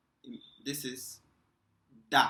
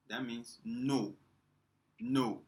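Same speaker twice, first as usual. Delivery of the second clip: ordinary talk, nothing marked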